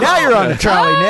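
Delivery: high, loud vintage slang; old-timey voice. Pretend. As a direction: play a 1920s wiseguy.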